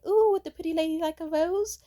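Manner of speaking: high, loud, cooing saleswoman; rose seller's sales pitch. Retold or imitated. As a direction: coo an offer in a high put-on voice. Acts out the rose-selling woman.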